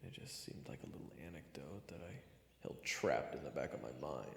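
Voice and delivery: usual speaking voice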